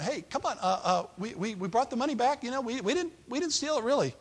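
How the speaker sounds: high, stammering plea; begging. Acted. Brothers begging the steward over returned money, stammering plea. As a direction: protest innocence in a nervous high voice. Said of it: "As they're begging".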